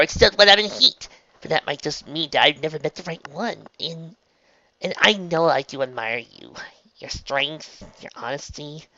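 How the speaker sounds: high character voice, swelling then faltering; flustered Trixie confessing. A narrator's reading. Trixie confesses mixed feelings to Raindrops, flustered.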